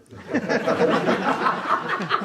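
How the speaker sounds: big burst of high laughter; loud high laugh. Real, not acted. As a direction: let out a real belly laugh. Carcajada tras la broma. (carcajada fuerte y aguda)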